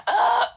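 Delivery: sudden sharp hiccup, high and loud; a real hiccup bursting out. Real, not acted. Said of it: "I have the hiccups".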